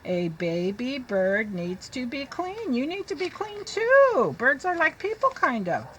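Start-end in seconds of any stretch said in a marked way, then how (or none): 0.0-2.7 very slow, careful read-aloud; teacher reading to children. Shown as a demonstration. 2.7-4.3 much higher, bright teasing tone; teacher turning the page to the kids; playful question to kids. Shown as a demonstration.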